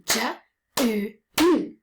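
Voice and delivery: "J", then "oo, oo", each sound clapped out separately; clapping the sounds. Shown as a demonstration. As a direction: segment the word sound by sound, stretching "oo". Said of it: "The first word is June that we're going to clap".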